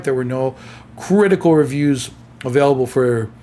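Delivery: words into a yawn. Real, not acted. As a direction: let a yawn take over. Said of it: "sorry, I'm yawning".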